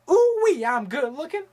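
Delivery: high, squealing ooh wee then a rising boast; movie villain squeal. Retold or imitated. Vlogger quoting Face/Off, squealing in a high voice. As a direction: squeal it with delight.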